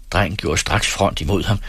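ordinary delivery, nothing marked

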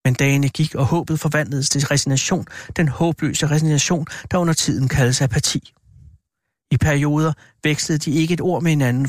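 ordinary delivery

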